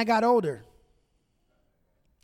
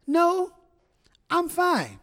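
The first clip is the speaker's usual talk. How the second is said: high, sweet mock wife voice; imitating her sweet brush-off; sweet and pleasant; 9 semitones higher, 3 dB louder, slower than usual. Husband imitating wife's sweet polite refusal. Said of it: "sweet and pleasant".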